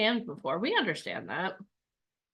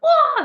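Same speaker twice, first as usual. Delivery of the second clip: very high, loud mock squeal of excitement; shrieking excited wail; 8 dB louder than usual. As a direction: let out a sudden high squeal. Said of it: "You're like".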